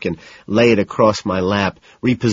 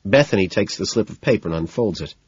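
ordinary delivery, nothing marked

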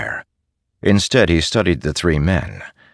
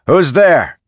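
much higher, loud call into the dark; soldier's sharp challenge; 12 semitones higher, 8 dB louder than usual. Acted. Soldier at the fire calls toward the snapped twig, loud challenge. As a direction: challenge the unseen intruder.

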